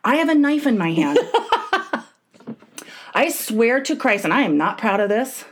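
ordinary delivery

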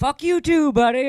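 high heckler voice; heckler's retort. Retold or imitated. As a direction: throw it back like a smug heckler. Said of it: "And he's like".

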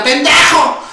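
high yelled insult from a car; imitating a driver yelling an insult; retold street insult. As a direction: hurl the insult like an angry driver. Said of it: "me grita".

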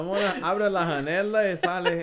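slow, loud fake Spanish, janela as hanela; mock Spanish. Imitating interviewer in mock Spanish, slow and loud. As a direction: ham up a broken Spanish line. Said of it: "Ele fala".

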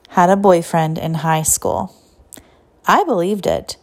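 ordinary delivery

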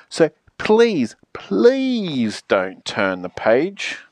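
slow, stretched begging; implore, drawing out the second "please"; final plea. Acted. Final plea before the last page.

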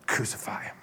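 hushed, almost whispered order; resigned quiet verdict. Acted. Pilate giving in to the crowd, hushed verdict. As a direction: let it drop softly, giving in.

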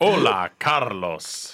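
slow, drawn-out Spanish hola; slow Spanish greeting. Acted. Host greets Carlos in slow mock Spanish.

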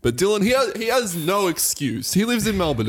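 high, indignant teasing; ribbing a friend who isn't there; excited. Real, not acted. Ribbing absent friend, pitch jumps.

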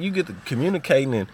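usual speaking voice

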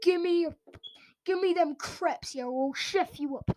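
mock Birmingham street accent, lightly done; a hint of a Brummie roadman; lightly imitating Birmingham street talk; street Birmingham slang. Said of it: "they'll just go".

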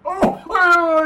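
high yelping cry of horror; shocked realization. Retold or imitated. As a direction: gasp it out as the penny drops. Sudden realization of the gaffe.

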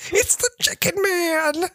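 silly high reveal. Retold or imitated. Host landing a joke about Andy's dad, high and slow. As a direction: announce it like a goofy villain.

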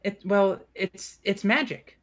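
put-on high, halting child voice; high-pitched kid searching for words; little elven girl. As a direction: explain hesitantly as a small girl. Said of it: "little girl pops her head up".

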